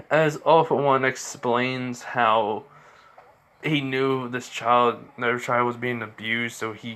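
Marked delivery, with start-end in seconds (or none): none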